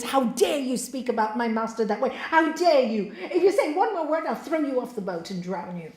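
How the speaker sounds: outraged, loud storyteller's disciple; indignant threat, hammered out loudly at the start; angry disciple. Acted. Disciple defending his master to the boatman, angry. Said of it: "disciple got really angry".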